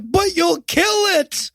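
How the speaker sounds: high-pitched, hammed-up plea; mock protest. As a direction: wail it like an alarmed movie character. Acted protest in retold argument.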